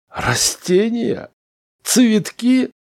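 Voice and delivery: high-pitched, drawn-out astonishment; incredulous. A narrator's reading. Smith hears that plants rule, incredulous. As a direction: squawk it in utter disbelief. Smith stunned by Chauns's answer.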